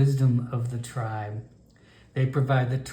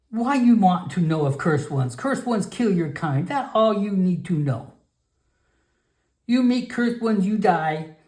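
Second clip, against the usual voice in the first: put-on scolding old shaman; 7 semitones higher than usual. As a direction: scold the foolish child.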